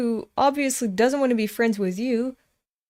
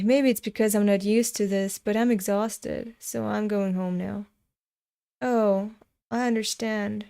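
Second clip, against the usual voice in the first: normal talking voice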